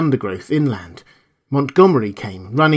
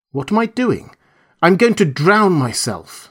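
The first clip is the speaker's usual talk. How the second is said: defiant retort; 6 semitones higher than usual. A narrator's reading. Narrator's character answers the shouted question.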